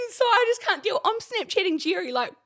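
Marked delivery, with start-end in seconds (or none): 0.1-1.0 high, exasperated can't-deal voice; tossed-off mock despair, pitched up; retelling her own fed-up line; exasperated quote. Retold exasperated quote to friend.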